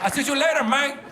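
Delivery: put-on little boy with a Guatemalan accent. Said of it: "Guatemalan accent when he talks".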